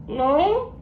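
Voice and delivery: very high squawky parrot speaking. Acted. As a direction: talk back as a cheeky parrot, very high. Parrot repeats syllable.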